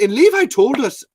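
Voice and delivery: high, loud, badly faked Irish accent; hammed-up Irish complaint; Colin. Host imitating Colin grumbling about Levi, bad Irish accent. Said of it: "that was a terrible impression".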